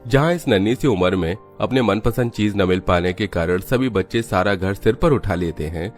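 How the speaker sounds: plain reading voice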